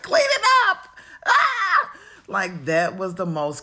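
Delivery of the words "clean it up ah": shrieked high and loud, a mother at the end of her rope; mock yell at the kids. Mother yelling at messy kids, shrill mock scream. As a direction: screech it like a fed-up mom.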